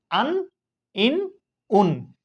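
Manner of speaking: আন ইন উন each clipped short with a nasal n ending; nasal tanween endings. Shown as a demonstration. Teacher demonstrating আন ইন উন to students, short with a nasal ending. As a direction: sound আন ইন উন like noon sakin, short and nasal. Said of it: "এরকম উচ্চারণ হবে".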